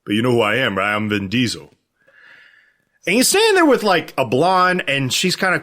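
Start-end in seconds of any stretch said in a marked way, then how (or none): 0.0-1.7 lightly voiced low action star; hint of a self-important movie tough guy; slightly put-on low celebrity voice; Vin Diesel. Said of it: "He's like".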